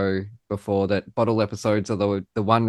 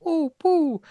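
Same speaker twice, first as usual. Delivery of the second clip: much higher Pooh attempt; one more reach for Pooh's cadence. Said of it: "he just has that, that".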